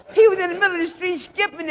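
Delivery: can barely get it out laughing; laughing narration. Real, not acted. Laughing describing skipping cop.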